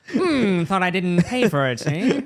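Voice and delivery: high, mock-suspicious interrogator; mustache-twirling detective. Joking as a mustached store accuser, mock-suspicious. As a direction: play a hammed-up mustache-twiddling accuser. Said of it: "twiddling your mustache".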